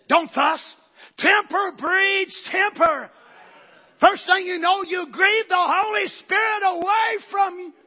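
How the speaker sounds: loud, high, slow preaching shout; preaching at full force. Real, not acted. Preacher warning the church against fussing, shouting. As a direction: drive each word home from the pulpit.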